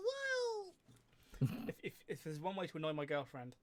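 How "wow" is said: squeaky falsetto exclamation; high squeaky wow. Shown as a demonstration. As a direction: leap way up into falsetto. Shows voice can go high.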